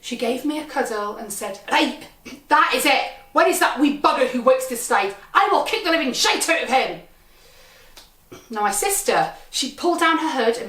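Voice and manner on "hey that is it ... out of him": louder, higher voice of an outraged mother; angry mum. Retold or imitated. Mum threatens the ride worker after cuddling her child, angry. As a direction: play a mum on the warpath.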